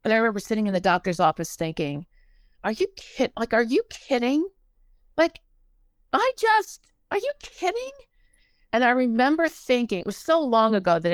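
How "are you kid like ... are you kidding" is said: stunned disbelief. Retold or imitated. Disbelief at diagnosis, rising pitch.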